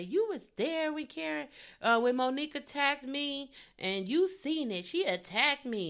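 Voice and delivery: high, whiny mock of a dramatic accuser; mocking dramatic Candace. Host mocking Candace's fake crying complaint, whiny and high. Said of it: "she started to say".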